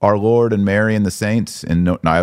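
normal talking voice

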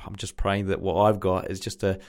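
normal talking voice

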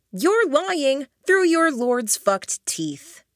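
slow, high, biting accusation; angry accusation. Acted. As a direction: spit out each word of the accusation. Narrator confronts the lying witch.

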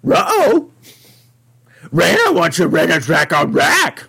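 garbled, loud cartoon dog voice; put-on talking-dog yelp; Scooby. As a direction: talk like a cartoon dog, starting high.

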